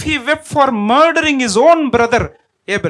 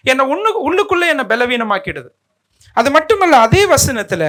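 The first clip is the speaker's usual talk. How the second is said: ordinary talk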